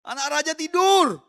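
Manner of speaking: high, drawn-out holler; mimicked shouting. Preacher mimicking the neighbours' cries for the congregation, shouted high. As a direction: yell it slowly, imitating distant criers. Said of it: "mereka sudah teriak-teriak".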